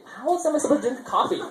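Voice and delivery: put-on high, incredulous kid whine; exasperated kid. Boy questioning his mom's rule, high mock whine. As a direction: sound baffled by the rule. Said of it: "And I'm like".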